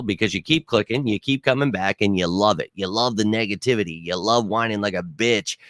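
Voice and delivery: usual speaking voice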